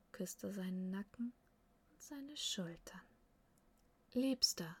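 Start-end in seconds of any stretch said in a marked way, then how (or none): 4.1-4.8 soft endearment. A narrator's reading. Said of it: "sagte sie leise".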